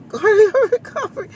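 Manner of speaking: high squeaky laugh-through. Pretend. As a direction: squeak it out giggling. Repeats phrase with a laugh.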